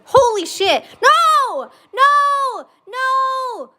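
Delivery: high, loud real panic screams; panicked scream. Real, not acted. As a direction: shriek at the crash, real. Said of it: "That was so genuine".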